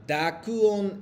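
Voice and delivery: "Dakuon" stretched very slowly, syllable by syllable; drawn-out term. Shown as a demonstration. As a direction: lean on "Dakuon" and take your time. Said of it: "stretching the new term".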